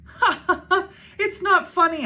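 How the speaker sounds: high loud laugh through words. A narrator's reading. Laughing while protesting.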